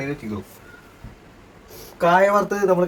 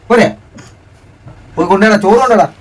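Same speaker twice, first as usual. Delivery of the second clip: loud, high call across the house; 7 semitones higher, 11 dB louder than usual. Real, not acted. Father calling to child to fetch rice, loud and high.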